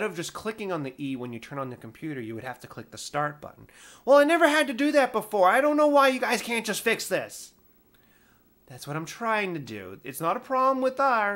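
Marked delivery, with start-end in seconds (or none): 4.1-7.5 much higher, louder, fast complaining customer; hammed-up indignant caller; exasperated caller.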